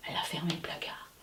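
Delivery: hushed storyteller whisper. A narrator's reading. Narrator telling of the noise in the dark, whispering. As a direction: whisper it to build suspense. Suspense in bedroom.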